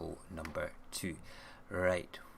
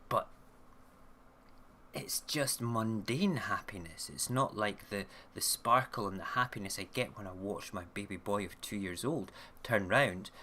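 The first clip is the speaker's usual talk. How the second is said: ordinary talk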